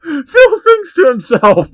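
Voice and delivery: slow, high and loud, still shaking with laughter; laughing through it. Real, not acted. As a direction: choke out the line through real laughter. Reader still laughing while narrating.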